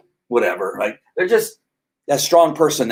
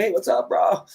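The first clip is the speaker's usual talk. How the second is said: lightly voiced easygoing dog, higher pitch; casual, friendly dog greeting; a mellow pup saying hi; laid-back dog; 8 semitones higher, 4 dB quieter than usual. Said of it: "a mild dog".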